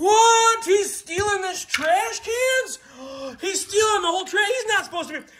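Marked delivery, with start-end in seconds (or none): 0.0-2.8 high, loud astonished what; playful disbelief, pitched way up; shocked outburst. Pretend. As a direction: gasp it out in mock outrage. Shocked at toy truck's theft.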